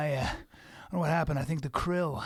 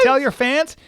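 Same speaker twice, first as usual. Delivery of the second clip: loud, raised questioning echo; incredulous repeat; 6 semitones higher, 9 dB louder, slower than usual. Acted. Translator repeating the whale's message, loud and incredulous. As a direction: echo it back in loud disbelief.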